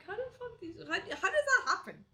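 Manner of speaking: high-pitched mock bafflement, rising to loud; baffled disbelief. Listener reacting to nice words sounding mean, baffled disbelief.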